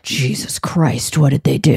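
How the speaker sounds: low, slow, horrified mock dentist voice; imitating a stunned expert, low and slow; shocked dentist. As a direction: play the appalled dentist, dragging it out low. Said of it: "be like".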